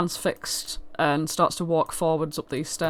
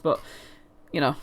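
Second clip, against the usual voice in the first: ordinary talk, nothing marked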